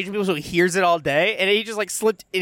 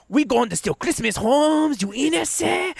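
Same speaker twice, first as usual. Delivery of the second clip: slow, higher-pitched mock Mexican accent; 8 semitones higher, slower than usual. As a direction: drag it out in a put-on Mexican accent. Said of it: "my Mexican voice on".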